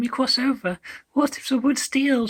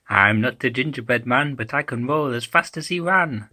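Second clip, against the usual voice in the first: low coin voice; 10 semitones lower than usual. Acted. Rolling coin voiced in rhyme.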